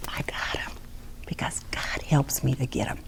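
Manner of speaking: soft conspiratorial whisper; whispered aside. Real, not acted. Speaker confiding her triumph to the audience, whispering. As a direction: share the secret of your success quietly.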